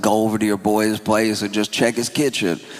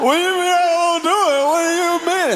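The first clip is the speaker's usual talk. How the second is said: climbing from a sharp retort to a shrieking high-pitched rant; indignant yelling; 12 semitones higher than usual. Retold or imitated. Buddy snapping at a friend who asked how he's doing, escalating yell. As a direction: get more and more offended each time you repeat it. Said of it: "His friend's like".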